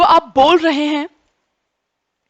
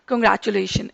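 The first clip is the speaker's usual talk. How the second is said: cold congratulations; 5 semitones lower, 6 dB quieter than usual. Shown as a demonstration. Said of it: "आपको खुशी मिलेगी ही नहीं".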